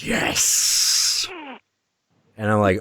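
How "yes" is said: hissed, whispery yes; sinister whispering demon; demon's voice. Retold or imitated. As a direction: hiss it out like a demon in someone's head. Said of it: "Then I hear the voice".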